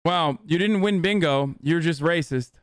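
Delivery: ordinary talk, nothing marked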